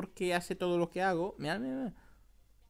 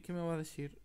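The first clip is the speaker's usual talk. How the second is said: ordinary talk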